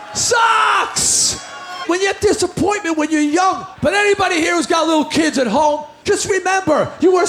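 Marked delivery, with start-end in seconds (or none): 0.1-1.4 socks said high and drawn out, disgusted. Shown as a demonstration. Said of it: "repeated for effect".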